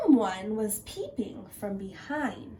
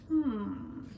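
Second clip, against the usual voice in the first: ordinary delivery, nothing marked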